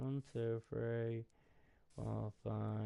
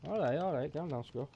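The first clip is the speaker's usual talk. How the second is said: soothing the dog; 4 semitones higher, 5 dB louder than usual. Real, not acted. Talking to the excited dog.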